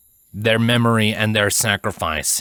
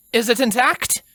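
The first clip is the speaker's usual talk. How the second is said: higher, eager, urgent question; urgent Twilight. A narrator's reading. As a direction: press for the answer, hopeful and quick. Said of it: "An equal amount of urgency was held in her tone".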